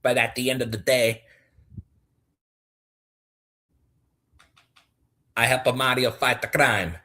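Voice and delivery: lightly voiced Italian plumber, bouncy; playful cartoon plumber; Luigi voice. Viewer request, a Luigi voice.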